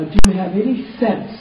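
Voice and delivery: ordinary talk, nothing marked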